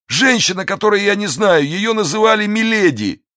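high, strained voice of a wounded bandit; frightened bandit. Acted. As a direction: plead in a thin high voice.

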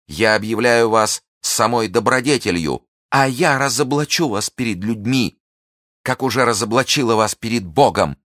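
high, loud, slowing at the end; accusing the lord before God, fierce; impassioned denunciation. Acted. Milady denounces him.

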